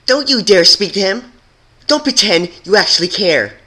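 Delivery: high, loud furious yell; furious shout. Acted. Silver pony forbidding Twilight to speak to him, furious shout. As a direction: scream it at her in fury. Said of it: "let out a small snarl".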